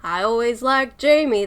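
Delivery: cowboy game character, attempted; loud, half-hearted gunslinger impression; Arthur Morgan. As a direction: try a cowboy voice, not quite landing it. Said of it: "where Arthur Morgan in the game says".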